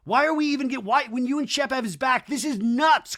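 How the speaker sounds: hammed-up outrage, voice climbing; mock indignant rant. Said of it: "And Craig is like".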